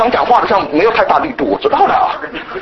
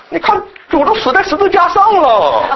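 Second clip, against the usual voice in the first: higher, slow, half-laughing guilt trip; mock guilt-trip; 8 semitones higher, slower than usual. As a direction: lay on the guilt with a smirk. Mimics guilt-trip preacher. (带笑模仿内疚式讲道)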